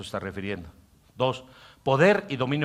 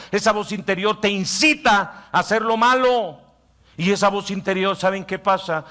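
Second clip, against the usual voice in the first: higher, louder sermon drive; full-force preaching; 8 semitones higher, 6 dB louder than usual. Acted. Pastor preaching about the inner voice, full force. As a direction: preach it hard to the congregation.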